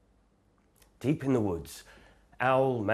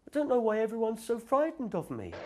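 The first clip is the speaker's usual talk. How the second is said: very high, timid little creature; small monster; 14 semitones higher than usual. Acted. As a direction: speak as a tiny shy monster.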